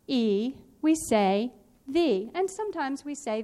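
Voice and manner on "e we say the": drawn out slowly, "the" said as "thee"; spelling voice, slow. Shown as a demonstration. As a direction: stretch it out as a spelling pronunciation. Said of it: "So we think to spell".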